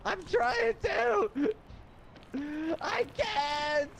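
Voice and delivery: high, slow strained whine; desperate whine. Real, not acted. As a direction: stretch it out, straining. Desperate reply while dying.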